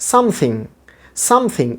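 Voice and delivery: something high on SOME, falling on thing; stress at the start. Shown as a demonstration. Teacher drilling first-syllable stress on something. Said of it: "শুরুতে একটা stress".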